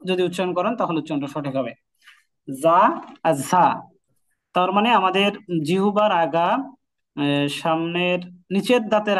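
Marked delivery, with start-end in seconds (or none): 2.5-3.8 জা and ঝা drawn out slowly, close together; slow জা then ঝা; Zaa near Jha. Shown as a demonstration. Teacher comparing the two sounds slowly. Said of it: "এটার কাছাকাছি".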